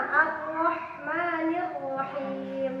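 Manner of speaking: Ar-Rahmanir Rahim drawn out, ro clear and medium; slow tajwid reading. Shown as a demonstration. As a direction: read Ar-Rahmanir Rahim slowly for the ro. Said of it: "Ro juga sama". (bacaan Ar-Rahmanir Rahim pelan, ro jelas)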